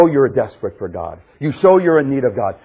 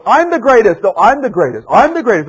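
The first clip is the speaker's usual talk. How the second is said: loud, high rival boasts; boastful squabbling; 7 semitones higher, 8 dB louder than usual. Acted. Disciples arguing who is the greatest, loud boasting. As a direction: outdo each other at high volume. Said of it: "they just had this discussion of who's the greatest".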